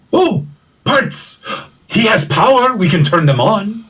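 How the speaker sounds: high, eager robot chatter; excited droid voice. Acted. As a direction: squeal with delight over parts. Excited droid character exclaiming.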